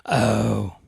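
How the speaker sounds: normal talking voice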